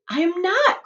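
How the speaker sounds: high, loud protest; cry. Acted. Charlie protests to Grandpa Joe, high and loud. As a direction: insist it is real. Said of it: "cried Charlie".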